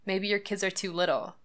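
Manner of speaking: normal talking voice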